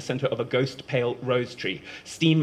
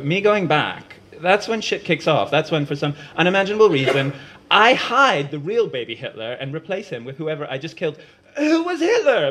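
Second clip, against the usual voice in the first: 1 laughing rant; 4 semitones higher, 5 dB louder than usual. Acted. Umeko's heated laughing rant.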